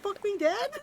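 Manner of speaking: high-pitched disbelief; Ron's high, stunned repeat of the question; incredulous. Retold or imitated. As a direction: ask it in high shock. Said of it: "he's like".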